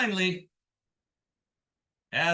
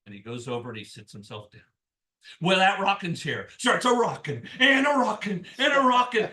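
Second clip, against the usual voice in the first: plain reading voice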